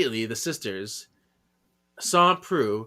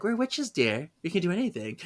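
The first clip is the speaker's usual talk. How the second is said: high, soft, patronizing; lightly voiced witch reassuring a child; sweet witch voice; 6 semitones higher, 3 dB quieter than usual. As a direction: coo it at the little girl. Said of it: "It's like".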